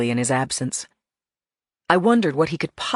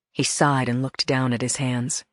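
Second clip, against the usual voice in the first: usual speaking voice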